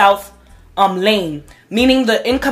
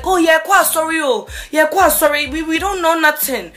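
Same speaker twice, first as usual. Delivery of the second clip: imitating a polite Ghanaian accent, pitched up; lightly put-on African accent, apologetic; mock apology to the cop in a light African accent; 7 semitones higher than usual. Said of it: "Doing some accent, accent".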